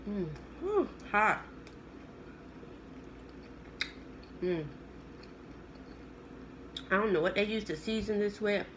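talking with mouth full; eating spring roll; chewing while talking. Real, not acted. Seen 0: Holds bitten spring roll, lips closed chewing between words throughout.

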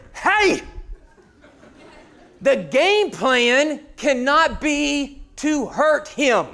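sharp high shout, then slow deliberate words. Retold or imitated. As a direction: lose your cool, slowly. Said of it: "Ten minutes later".

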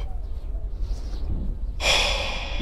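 long, soft breath out; relieved exhale. Real, not acted. Player just out of the horrifying house, relieved exhale. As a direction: let the scare out in one long breath.

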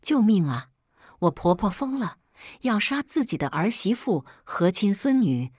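usual speaking voice

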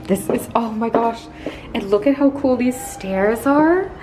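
ordinary talk

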